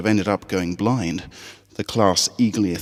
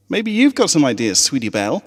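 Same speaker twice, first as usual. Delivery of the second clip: put-on higher, skeptical young filly; a doubtful little pony teasing her friend; 7 semitones higher, 3 dB louder than usual. Scootaloo doubting Apple Bloom's speech, high and skeptical.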